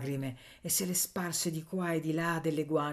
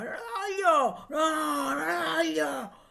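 very high, long-drawn cries; shouting; 12 semitones higher, much slower than usual. A narrator's reading. Miller protesting against the accusation, shouting. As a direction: wail it out in stretched, high protest. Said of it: "E il mugnaio gridava".

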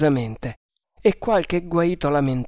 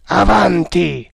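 forte e lento, un comando secco; ordine gridato; 4 semitones higher, 7 dB louder, slower than usual. Acted. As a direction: lancia l'ordine di avanzare gridando. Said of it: "gridò il capitano MacPherson". (ordine gridato con forza)

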